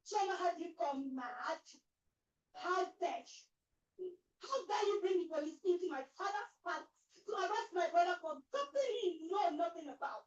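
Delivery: loud tearful outrage; angry confrontation. Acted. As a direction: rage at her through tears. Sister confronts Choma in palace.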